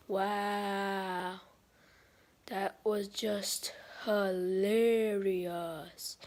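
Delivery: low, soft, bored drawl; sarcastic puppet. Pretend. Dino puppet brushing off the audience, low and bored. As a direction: sound utterly unimpressed and keen to leave. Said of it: "sarcastic type".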